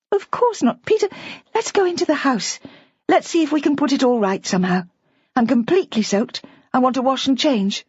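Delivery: put-on a young wife trying to reassure him; young woman Bridget. A narrator's reading. Bridget voiced by narrator.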